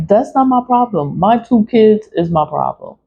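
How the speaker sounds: ordinary talk, nothing marked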